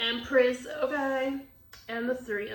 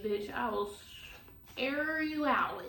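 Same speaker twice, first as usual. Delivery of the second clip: normal talking voice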